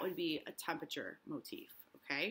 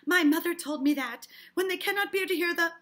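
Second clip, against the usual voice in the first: high, loud, urgent claim; agitated insisting; 10 semitones higher, 13 dB louder than usual. Acted. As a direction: insist on it at full volume. Mrs. Putnam insists, acted.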